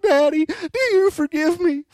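put-on high, weepy plea of a five-year-old; crying little girl. Crying daughter begs her dad to forgive her, high weepy plea. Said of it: "that just precious five-year-old cry".

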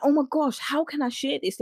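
high-pitched, bursting to share news; retold excitement. Woman bursting with weight-loss news, excited. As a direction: replay a giddy inner reaction. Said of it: "when you're excited with that kind of news".

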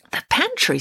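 high-pitched questioning echo; puzzled disbelief. A narrator's reading. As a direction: query it as a baffled child listener. Samantha puzzled by the story.

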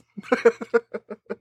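a high, breathy burst of laughter. Real, not acted. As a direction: laugh off the playful jab. Teasing shout out to Stacy.

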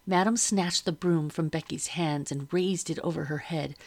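ordinary delivery, nothing marked

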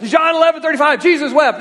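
put-on high, loud eager kid recital; rapid fire recital. Sunday school boy racing to recite first, high and loud. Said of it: "you'd be rapid fire".

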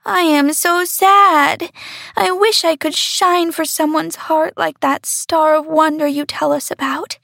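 a small star longing aloud; sad little star. A narrator's reading. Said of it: "said the golden star".

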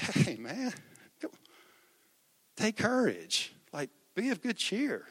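very soft, soothing reassurance; hushed, easygoing comfort; soft words. Acted. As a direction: gently encourage frightened friends. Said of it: "walked up there with soft words".

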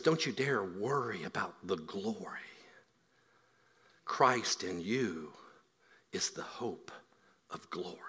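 dropping to a near whisper; soft and slow, lean in close; hushed divine promise. Acted. Preacher voicing God to the people, hushed and slow. God's words voiced softly.

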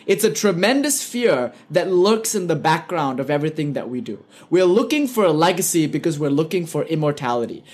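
ordinary talk, nothing marked

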